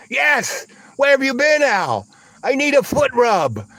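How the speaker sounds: high, loud hollering; imitated demanding woman. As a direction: holler it impatiently across the room. Retold woman hollering back at him.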